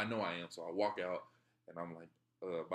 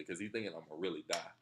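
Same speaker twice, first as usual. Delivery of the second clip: ordinary talk, nothing marked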